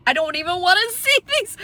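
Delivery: high-pitched mock horror with a laugh; disgusted protest. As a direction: recoil vocally, voice climbing high. Disgusted reaction to restroom pictures.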